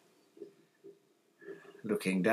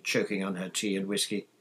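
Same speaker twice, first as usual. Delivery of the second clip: plain reading voice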